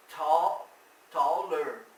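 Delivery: tall and taller said loud; stressed example words. Shown as a demonstration. Teacher contrasting tall and taller, loud. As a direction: punch tall then taller. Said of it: "mais alta".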